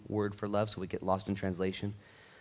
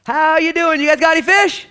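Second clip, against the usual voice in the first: loud, much higher, breezy playful call; casual Jesus; 18 dB louder than usual. Acted. Jesus on the beach teasing the fishermen with a loud, breezy hail. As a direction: tease the fishermen with a friendly shout. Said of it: "on the beach, it's Jesus Christ".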